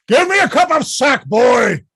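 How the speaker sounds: loud, high bellow; shouting. Acted. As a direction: roar for a drink. Falstaff shouting for drink.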